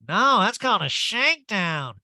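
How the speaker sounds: high, loud, swooping correction; hammed-up drawling impersonation; Erica. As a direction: caricature her as an emphatic know-it-all. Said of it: "Erica knew exactly what it's called".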